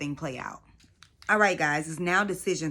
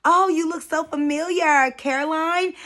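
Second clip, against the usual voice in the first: high, loud sugary greeting; sweet fake recognition; 8 semitones higher, 6 dB louder than usual. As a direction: put on a sweet, fake-friendly voice. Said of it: "Maggie was just like".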